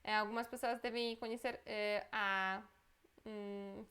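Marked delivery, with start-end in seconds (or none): none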